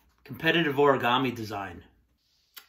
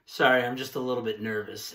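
usual speaking voice

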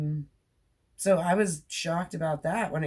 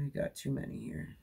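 whispered aside; 11 dB quieter than usual. Real, not acted. Muttered aside while walking.

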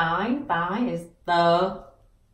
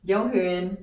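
ordinary talk, nothing marked

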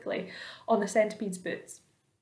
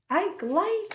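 high, dignified correction; prim earthworm; 11 semitones higher than usual. A narrator's reading. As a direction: say it primly, pitched high. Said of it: "said the earthworm primly".

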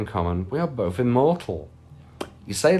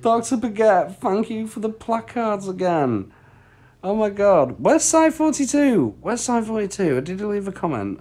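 much higher, excited cartoon voice; high silly voice; 11 semitones higher, 3 dB louder than usual. Streamer thanking a viewer in a squeaky voice. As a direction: thank them in a squeaky bit.

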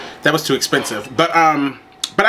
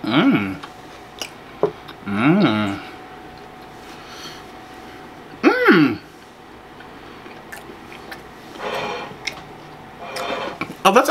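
humming while chewing food; chewing with mouth closed; 3 semitones higher, 5 dB quieter, much slower than usual. Real, not acted. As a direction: mouth full of taco. Seen 3: Closed lips keep shifting after taco bites; no food in hand now.

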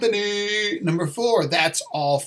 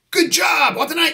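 very high, louder role-play outburst; hammed-up exclamation; 11 semitones higher, 4 dB louder, much faster than usual. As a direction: exclaim it high, playing out the exchange. Acted praise and retort.

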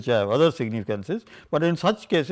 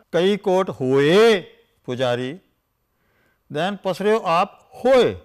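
slow emphatic verse, "hoye" pushed louder; emphatic recitation; 3 semitones higher, 4 dB louder than usual. Shown as a demonstration. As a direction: drag out "Kai kot hoye" and lean on it. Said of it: "you have".

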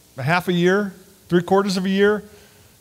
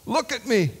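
soft, high, breaking plea; a whispered cry to God; hushed anguished plea; 5 semitones higher, 8 dB quieter than usual. Retold or imitated. David crying out to God, rendered softly. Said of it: "just screaming at God".

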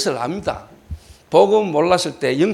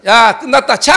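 loud, higher-pitched shout to a group; calling out; 5 semitones higher, 7 dB louder than usual. Retold or imitated. Pastor ends the football game, loud call.

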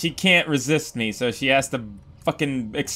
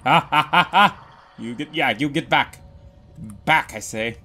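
ordinary delivery

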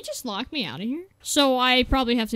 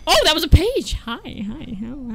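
ordinary talk

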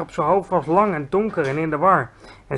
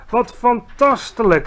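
drawn-out, higher cry of joy; beaming delight; 6 semitones higher, 4 dB louder, slower than usual. A narrator's reading. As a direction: marvel at it slowly, overjoyed. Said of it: "riep de G V R nog steeds stralend".

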